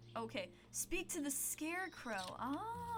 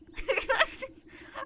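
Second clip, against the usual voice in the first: sob-laugh wail; 13 semitones higher, 10 dB louder than usual. Acted. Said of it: "great shuddering sobs".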